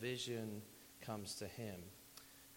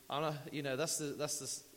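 ordinary delivery

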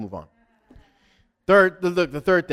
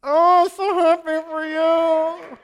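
very high, loud, gushing mock delight; shrieking fake excitement with a laugh; fake happiness; 14 dB louder than usual. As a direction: overdo the joy for a friend's wedding. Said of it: "You're like".

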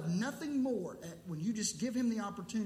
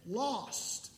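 normal talking voice

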